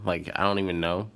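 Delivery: ordinary delivery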